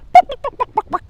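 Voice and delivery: high loud squawking clucks; chicken clucks. Acted. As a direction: cluck like panicked hens. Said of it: "cried the chickens".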